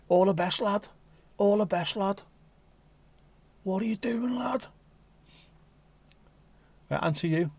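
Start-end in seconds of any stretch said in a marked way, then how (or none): 0.1-0.9 a touch of Scouse; Scouse accent. Pretend. Signing off to a viewer in mock Scouse. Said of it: "Not very Scouse, that, is it?". 1.4-2.2 higher, broader Scouse; stronger Scouse accent. Pretend. Repeating the viewer's sign-off in bolder Scouse. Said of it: "Not very Scouse, that, is it?". 3.6-4.7 camp Liverpool lad asking; Scouse accent. Pretend. Teasing the viewer in a high Scouse voice. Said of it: "Not very Scouse".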